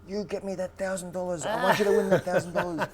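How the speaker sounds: high, far-off put-on voice, half laughing; demanding trainer. Trainer demanding his fighter win the money, mock stern. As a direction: push him like a greedy boss.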